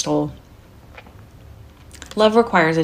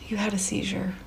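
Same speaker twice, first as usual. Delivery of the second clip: whisper; 3 semitones higher, 8 dB quieter than usual. Acted. Gently telling husband after seizure.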